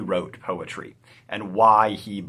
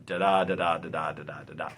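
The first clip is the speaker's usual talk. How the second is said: da-DA beats, weak then strong; iambic rhythm; 5 semitones lower, faster than usual. Shown as a demonstration. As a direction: tap out the iambic beat. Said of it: "there's an iambic pattern to them".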